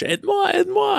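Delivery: very high cry for help; retold French scream. Swimmer calling to his buddy for help, mock screaming. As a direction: re-enact a panicked high shout. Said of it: "I'm screaming in French".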